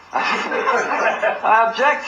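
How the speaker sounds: slow, high, laughing "I object to this"; laughing through it. Retold or imitated. Defendant objecting to the judge, slowly and laughing. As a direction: draw it out while cracking up.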